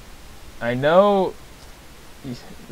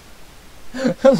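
startled laugh; 11 semitones higher, 4 dB louder than usual. Real, not acted. Startled by a leaf.

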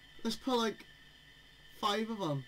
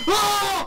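scream; 10 semitones higher, 11 dB louder than usual. Real, not acted. Jump scare scream.

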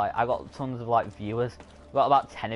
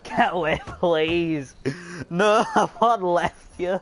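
loud, high-pitched plea breaking into laughter; laughing plea; 6 semitones higher, 6 dB louder, much slower than usual. Real, not acted. Streamer begging game pursuers to get away, loud laughing plea. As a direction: plead louder and higher, with laughs between.

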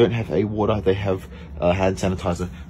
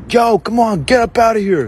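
high loud yell at sleepers; bikie yelling; 10 semitones higher, 5 dB louder than usual. Retold or imitated. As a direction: shout them off the pavement like a street tough. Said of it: "He's going".

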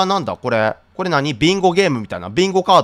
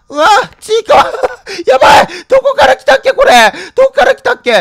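panicked high crying squeal; hit hard crying out; 8 semitones higher, 6 dB louder than usual. Real, not acted. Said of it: "やばい、ちいかわになる".

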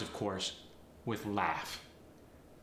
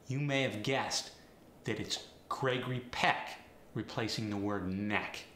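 normal talking voice

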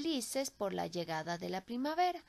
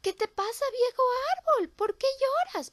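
high, louder concerned bird voice; worried little bird; 8 dB louder than usual. Acted. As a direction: ask the crying tree what's wrong. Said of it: "preguntó el pajarillo rojo".

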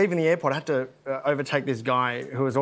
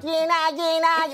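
high, piercing Australian squawk; piercing Aussie accent; 12 semitones higher, slower than usual. Acted. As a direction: squawk it like a broad Aussie abroad. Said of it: "it's just something piercing about it".

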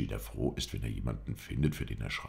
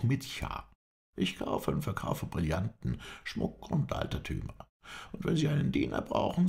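higher, eager servile caretaker voice; a humble trader offering his services, pitched up; 8 semitones higher than usual. A narrator's reading. Baruch introduces himself to the Effendi, eager and servile.

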